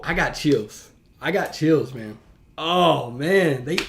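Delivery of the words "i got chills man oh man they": ordinary talk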